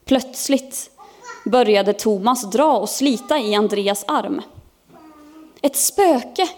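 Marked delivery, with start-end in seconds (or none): 5.6-6.5 high, alarmed exclamation; frightened cry. A narrator's reading. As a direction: cry out at sighting a ghost. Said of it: "ropade Thomas och pekade". (uppskrämt utrop med hög röst)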